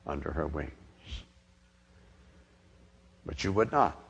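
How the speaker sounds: soft, grieving plea, the voice sinking low; quietly weeping lament. A narrator's reading. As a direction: mourn over the city in a hushed, heavy voice. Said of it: "and wept".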